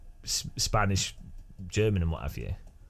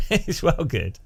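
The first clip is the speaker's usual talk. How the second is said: ordinary talk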